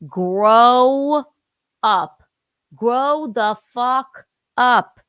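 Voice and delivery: loud, long-drawn "grow up"; pissed-off go-to. Acted. Wife showing her main fight line, angrily exasperated. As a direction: stretch each word out in exasperation. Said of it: "my main go-to is".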